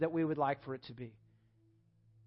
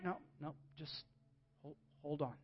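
soothing hush; 3 semitones lower, 15 dB quieter than usual. Retold or imitated. God calming the impatient child.

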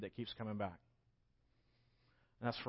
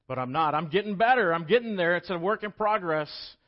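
high, loud, insistent preacher voice; climbing sermon declaration; impassioned preaching; 5 semitones higher, 9 dB louder than usual. Acted. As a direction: lift the room with hope. Preached build.

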